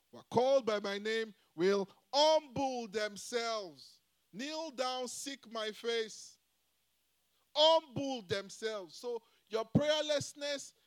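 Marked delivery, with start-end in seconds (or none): 0.1-8.9 slow, loud scripture proclamation, stretching humble; full-force preaching of the verse. Acted.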